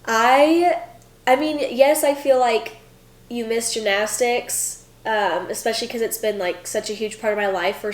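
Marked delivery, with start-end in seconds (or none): none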